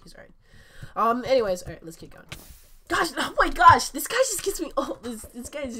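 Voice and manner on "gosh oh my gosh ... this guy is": high, loud, laughing frustration; loud high-pitched gamer exasperation; exasperated outburst. Real, not acted. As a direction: cry out at being beaten yet again. Beaten by same player again.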